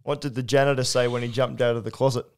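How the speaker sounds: ordinary talk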